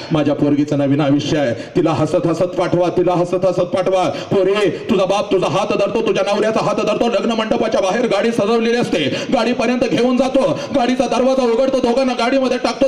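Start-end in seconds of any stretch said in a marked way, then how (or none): none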